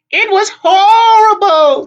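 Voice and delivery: slow, very high, loud wail; horrified hag. A narrator's reading. Tempest recalls disaster, wailing.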